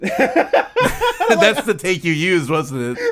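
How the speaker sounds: words squeezed out through laughter; high, slow, laughing hard. Real, not acted. Laughing at the recorded take.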